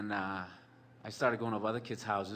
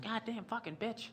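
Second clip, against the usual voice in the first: mild mock yell; 11 semitones higher, 5 dB quieter than usual. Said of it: "always screaming".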